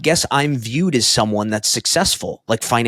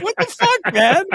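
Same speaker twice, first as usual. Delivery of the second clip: mock shocked outrage; 3 dB louder than usual. Shocked reaction to the insult.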